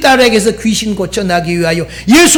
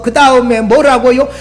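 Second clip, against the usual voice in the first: ordinary delivery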